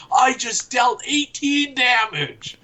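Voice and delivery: high, slow mock-triumphant gamer cry; stretched-out, geeky battle boast; role-playing gamer. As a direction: announce the hit in a high, hammed-up voice.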